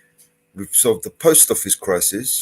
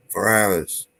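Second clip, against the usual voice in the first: ordinary talk, nothing marked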